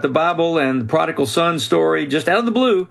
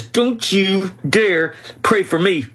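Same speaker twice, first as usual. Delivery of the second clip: slow, higher, menacing warning; spitting through gritted teeth; 5 semitones higher, slower than usual. Retold or imitated. Professor leaning in to threaten the man praying, teeth gritted. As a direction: jab each word like a wagging finger. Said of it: "he's spitting through gritted teeth".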